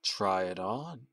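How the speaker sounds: slow, soft, sheepish suggestion. Acted. Caller shyly suggesting the towel, soft and slow. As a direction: offer it shyly and slowly. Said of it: "he sheepishly suggested".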